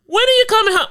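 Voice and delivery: put-on high little kid asking; high-pitched kid voice; child voice. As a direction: squeak it like a small child missing dad. Imitating child on phone.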